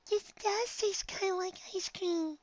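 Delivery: high, soft, slow voice; timid little pony. A narrator's reading. Shy pony tasting snow, timid wonder. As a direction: marvel quietly in a tiny voice.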